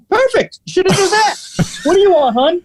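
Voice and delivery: hammed-up waiter praise; gruff waiter, now pleased. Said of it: "Really super aggressive".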